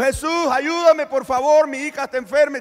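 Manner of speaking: loud, high, slowed begging cry; a mother pleading loudly for her sick daughter; desperate plea. Acted. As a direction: cry out after him for help. Woman begging Jesus for help.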